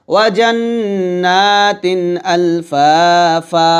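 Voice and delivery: slow, stretch the final আ of আজওয়াজা; আজওয়াজা drawn long; stretched ending. Shown as a demonstration. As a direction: hold আজওয়াজা's last vowel one alif. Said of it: "টান দিব".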